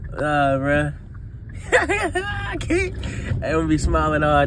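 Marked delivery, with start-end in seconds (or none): 1.7-3.0 laughing. Real, not acted. Laughing at his new braces.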